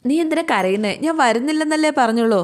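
a touch of an irritated big brother; mild exasperation. Acted. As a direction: asks his crying sister why, mildly fed up. Brother scolds crying sister.

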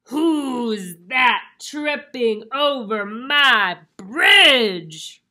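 very loud, slow, scary troll voice; roaring troll. Acted. Troll challenges the goat crossing his bridge, roaring. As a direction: bellow it, stretching out each word. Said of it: "roared the troll".

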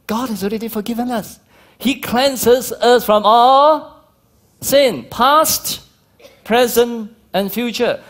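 high, loud, slow preaching; preaching at full force. Acted. Preacher proclaiming forgiveness to the congregation, ringing and loud.

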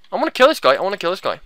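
normal talking voice

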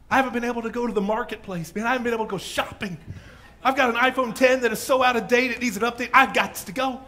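put-on fast, high, loud eager teen; excited teenager. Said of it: "Maybe there was a teenager".

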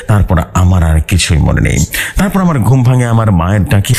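plain reading voice

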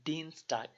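"দিনস্টাগ" with the ie drawn out long. Shown as a demonstration. Tutor showing students the long German ie. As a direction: stretch the ie in "দিনস্টাগ". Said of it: "ইটা একটু লম্বা করে টান দিতে হবে".

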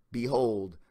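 ordinary delivery

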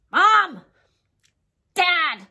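loud high call across the house. Acted. Calling the reader's parents to tattle, loud call. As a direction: yell for mom and dad to come.

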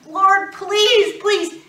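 loud, high, pleading cry to God; desperate begging. Retold or imitated. Childless woman begging God at the altar, pleading. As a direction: beg the Lord like there's nothing left. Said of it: "And I'm sure she was like".

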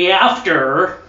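"After" in an exaggerated American accent, hard R; mock American accent on "After". Shown as a demonstration. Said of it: "you have to say it in a proper accent".

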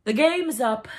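loud, high outburst from a pirate captain; passionate cry. A narrator's reading. Pirate captain declares defeat to his crew, passionate cry. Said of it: "at last he spoke passionately".

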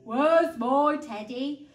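slow, loud bear whine; great big plaintive voice; big whining bear. A narrator's reading. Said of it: "A great big voice whined".

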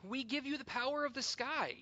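high, loud fervent cry; cried out. Acted. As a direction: call it out to the whole hall with fervour. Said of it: "we cried".

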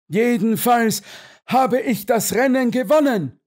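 very high, excited exclamation; gasped out. A narrator's reading. Colonel claims the win, blurting it out excitedly. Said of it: "stieß der Oberst keuchend heraus".

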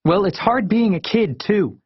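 put-on high-pitched angry kid voice. A narrator's reading. Kid talking back to parents, angry. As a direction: protest hotly, pitched right up. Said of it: "I sounded so angry".

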